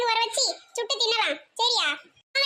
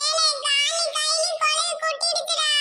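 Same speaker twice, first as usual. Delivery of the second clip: higher, tearful wail; crying in pain; 3 semitones higher than usual. Acted. Stung boy crying to his friends about the pain, tearful. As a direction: sob it out, hurt and scared.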